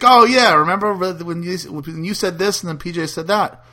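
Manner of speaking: high, excited reminding; eager fan. Retold or imitated. Host imitates an emailer recalling the show, eager.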